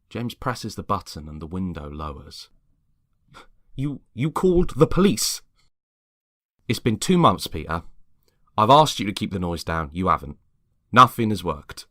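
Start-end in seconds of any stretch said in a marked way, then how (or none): 3.7-5.4 stammering, high and loud; stunned, can't believe it; shocked disbelief. Acted. Neighbour confronting James, high and loud in shock. 6.7-11.9 firm annoyance. Acted. James standing firm with neighbour.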